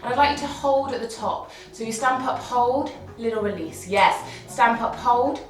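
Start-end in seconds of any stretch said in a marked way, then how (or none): none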